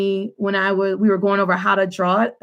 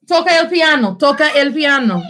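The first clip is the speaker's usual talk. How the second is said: parental order; 6 semitones higher, 4 dB louder than usual. Real, not acted. Mother sending child off to play piano, insistent. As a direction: repeat it firmly, pitched up.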